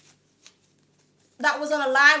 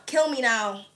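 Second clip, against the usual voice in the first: ordinary talk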